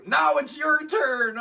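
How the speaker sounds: very high, drawn-out mock taunt. Guitar virtuoso who suffered, handing it to listeners, high mock taunt. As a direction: stretch it out in a high put-on voice. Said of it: "like, [name]'s School of Guitar Playing".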